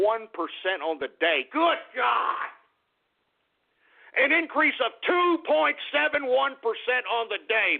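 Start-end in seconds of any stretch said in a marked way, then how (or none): 1.5-2.5 high-pitched gleeful outburst; whooping exclamation at big news; excited exclamation. Real, not acted. Crowing over the price jump. 4.2-7.8 high-pitched, jubilant repeat of the number; thrilled market hype, pitched way up; excited crowing. Real, not acted. Gleefully repeats the figure.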